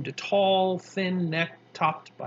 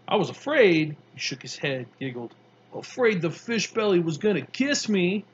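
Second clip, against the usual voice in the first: put-on higher, louder streetwise kid telling his tale; a tough kid, amused, spinning his story; Mars Bar voice; 4 semitones higher, 5 dB louder than usual.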